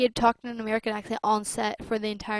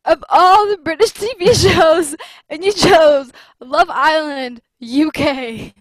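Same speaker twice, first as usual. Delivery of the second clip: laughing; 6 semitones higher, 14 dB louder, slower than usual. Real, not acted. Teasing friend about show choice.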